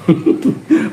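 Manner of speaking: a short breathy laugh that rises; unvoiced laugh. Real, not acted. Speaker at a rally laughing between jabs, breathy laugh. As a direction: laugh under the breath, then back to the rant.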